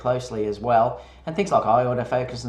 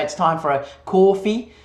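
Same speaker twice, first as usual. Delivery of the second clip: playful New York accent on It's time for a coffee; hammed-up New York accent, a bit higher; mock New York accent; 6 semitones higher, 3 dB louder than usual. Shown as a demonstration. Co-host jokes about his coffee line in mock New York accent. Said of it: "I try and put my New York accent in there".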